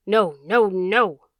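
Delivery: loud howled refusal, words drawn into a wail; malevolent howl. A narrator's reading. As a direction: throw it back as a howl. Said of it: "in a malevolent howl".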